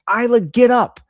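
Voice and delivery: put-on dad startled at toddler on the carpet; sharp call. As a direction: yell at her to get up now. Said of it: "I was like".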